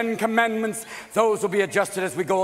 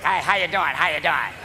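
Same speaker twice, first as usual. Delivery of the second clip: lower-pitched New York street greeting; New York rat; 4 semitones lower than usual. Acted. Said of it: "One New York rat".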